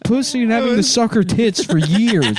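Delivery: grandpa spiraling through gross memories; manic grandpa. Acted. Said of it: "grandpa rambling".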